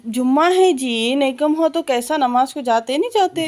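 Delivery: urging call. Real, not acted. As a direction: push him gently but loudly.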